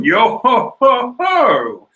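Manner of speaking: high, loud, slow stylized laugh; Brook. Acted. Skeleton answering a staredown, drawn-out theatrical laugh. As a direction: draw out a jolly skeleton chuckle. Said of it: "Brook is just like".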